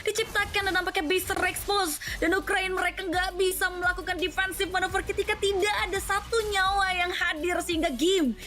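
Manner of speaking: high-pitched, climactic game-ending call; fighting game announcer. Acted. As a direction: ride the hype to the final push. Live play-by-play game end.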